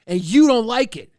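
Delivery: loud, stress on you; loud jab. Acted. Streamer barking back at chat, loud with stress on you. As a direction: bark it back at them. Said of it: "I like barking".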